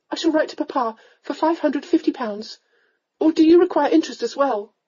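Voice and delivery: high-pitched heroine with rising temper; high, proper voice, offended; indignant young lady. Acted. Young lady offers to repay the lord, indignantly.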